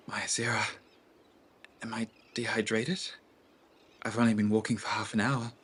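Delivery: put-on a queasy young woman asking herself; Nima's inner voice. Nima's sick, startled thought.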